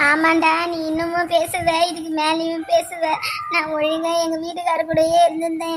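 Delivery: sister sobbing out her hurt at her brother; crying through the complaint; tearful crying. Acted. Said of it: "அதுவும் எதுக்கு அழுகுற?".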